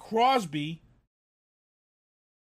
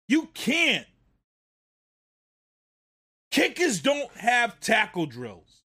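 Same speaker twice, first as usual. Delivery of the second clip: ranting; 2 semitones higher than usual. Real, not acted. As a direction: let the frustration burst out. Ranting at the player.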